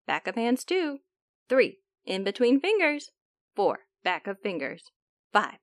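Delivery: ordinary delivery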